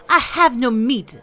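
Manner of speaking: loud, high irritated retort; snapping. A narrator's reading. Tante Adela refusing to give meat, snapping. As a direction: snap it out in a French accent. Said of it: "snapped Tante Adela".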